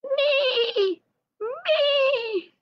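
very high bleating Me; sheep bleating. A narrator's reading. Lamb Lumi crying from the well, high bleat. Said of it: "cry out to the little shepherdess".

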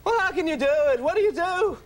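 high, incredulous comic questioning; hammed-up observational comedian voice; Jerry Seinfeld. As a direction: pitch it way up, querulous sitcom banter. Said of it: "Such a Seinfeldy bit".

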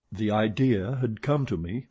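usual speaking voice

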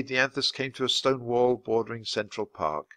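usual speaking voice

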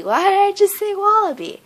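very high, loud self-exasperation; exasperated squeal. Real, not acted. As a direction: squeal it in disbelief at your own slip. Exasperated at own repeated slip.

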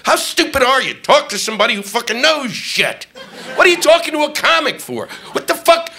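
high-pitched exasperated rant at the reporter. Retold or imitated. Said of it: "I said".

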